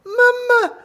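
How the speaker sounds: very high, loud cry for mum; shrill little child's distress call. A narrator's reading. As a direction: squeal like a panicking baby gorilla. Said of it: "cried Abu".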